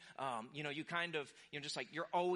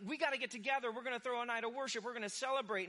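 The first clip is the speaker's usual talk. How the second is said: higher, louder, fast rallying call; rally everyone to celebrate; excited party call; 6 semitones higher, 4 dB louder, faster than usual. Retold or imitated. Philistines planning a night of worship, excited rallying call. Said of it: "And it's like".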